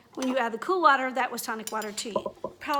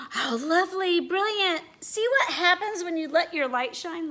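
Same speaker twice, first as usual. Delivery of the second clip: higher, louder cheering voice; proud friends; 5 semitones higher, 5 dB louder than usual. Acted. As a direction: praise her warmly and brightly. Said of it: "faces of her friends".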